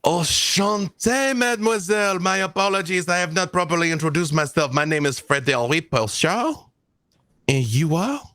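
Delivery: sweet-talking French gentleman with a high French lilt; French charmer. Acted. French NPC greets Belladonna.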